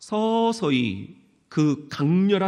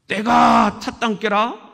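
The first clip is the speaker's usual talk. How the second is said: loud higher Jeolla-dialect proclamation; Jesus in dialect; 7 semitones higher, 5 dB louder than usual. Pretend. Jesus imagined preaching in Jeolla dialect, a loud proclamation. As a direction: proclaim it like a country preacher, shouting. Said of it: "예수님도 이렇게 말씀하지".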